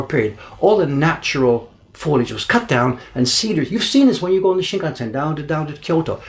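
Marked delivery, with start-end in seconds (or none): none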